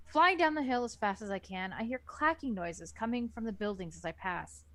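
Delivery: ordinary talk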